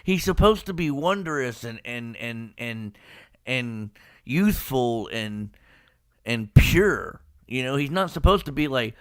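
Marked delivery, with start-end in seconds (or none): none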